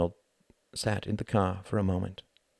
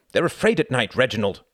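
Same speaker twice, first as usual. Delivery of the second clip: high, loud, irritated retort; snapping; 5 semitones higher, 6 dB louder than usual. A narrator's reading. Wendy to Reginald without turning to look, snapping. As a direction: cut him down sharply. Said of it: "snapped Wendy".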